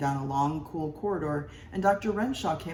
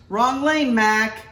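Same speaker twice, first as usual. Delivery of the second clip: much louder, higher parking attendant; yelling attendant; 7 semitones higher, 10 dB louder, much slower than usual. A narrator's reading. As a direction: shout at a careless driver.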